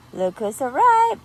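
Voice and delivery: ordinary delivery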